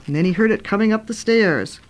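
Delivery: ordinary delivery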